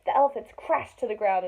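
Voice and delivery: ordinary delivery, nothing marked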